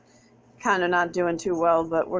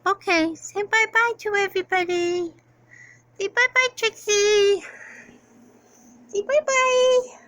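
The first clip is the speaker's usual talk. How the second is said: high coaxing goodbye; pet baby talk; 13 semitones higher than usual. Acted. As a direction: coax the cat to wave.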